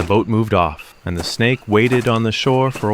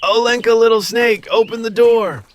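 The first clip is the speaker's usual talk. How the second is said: high snake mother's call; 12 semitones higher than usual. Acted. Snake calling her daughter to open the door, high. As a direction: call to be let in. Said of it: "she called".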